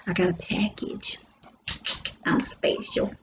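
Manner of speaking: whispered, playfully smug; teasing whispered boast; mock secret whisper. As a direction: hush it like a little secret brag. Joking about getting a package.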